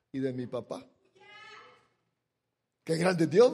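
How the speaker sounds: ordinary talk, nothing marked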